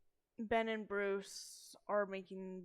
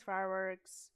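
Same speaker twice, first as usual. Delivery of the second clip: ordinary talk, nothing marked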